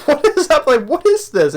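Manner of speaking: high laughing disbelief; a viewer stunned by the gimp outfit; baffled. Retold or imitated. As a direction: react in laughing confusion. Own baffled reaction retold.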